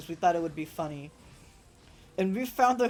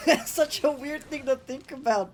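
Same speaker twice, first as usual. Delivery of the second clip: laughing; 9 semitones higher, 7 dB louder than usual. Real, not acted. Laughing at own weird thought.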